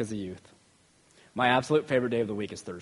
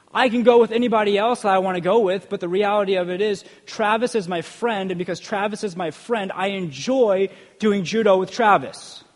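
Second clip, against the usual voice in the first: higher, louder sermon delivery; impassioned preaching; 7 semitones higher, 6 dB louder than usual. Real, not acted. Preacher telling the congregation why he values his friend, impassioned. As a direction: preach it with raised voice and conviction.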